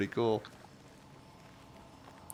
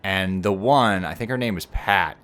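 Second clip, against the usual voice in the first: normal talking voice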